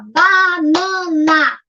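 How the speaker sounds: BANANA very slowly, BA, NA, NA, each held; syllable by syllable. Shown as a demonstration. Teacher models a child counting, syllable by syllable. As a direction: stretch BANANA into three long beats. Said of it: "três sílabas".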